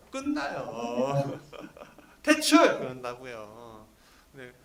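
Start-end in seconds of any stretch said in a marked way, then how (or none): 2.2-2.6 short yell, much higher and louder; loud mock dismissal; mock verdict shout. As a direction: announce the firing with a mock shout.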